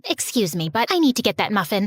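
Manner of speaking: usual speaking voice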